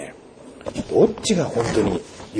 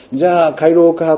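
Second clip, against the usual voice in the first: light, raised call to head home; 6 semitones higher, 6 dB louder than usual. Retold or imitated. Villagers wrap up at the river, calling out. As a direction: voice a villager calling the others, higher and louder.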